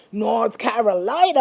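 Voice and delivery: rising excited build on the place name; excited reveal. Real, not acted. Host hyping surprise news from North Carolina, excited. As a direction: draw out the big reveal, pitch climbing.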